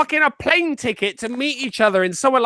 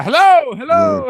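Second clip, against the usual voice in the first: echoing hello yelled louder, then climbing high; yelling into a jug; 7 semitones higher, 4 dB louder, slower than usual. Pretend. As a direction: call it out like into a hollow jug. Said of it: "Just yell into it".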